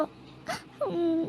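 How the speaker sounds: soft whimpering sob; a tiny choked-back sob; a quick, quiet cry between words; small sob. Acted. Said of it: "ಯಾಕೆ ಅಳ್ತಾ ಇದೀಯಾ?".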